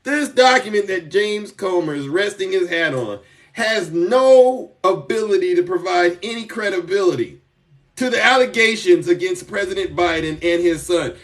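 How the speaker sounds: ordinary talk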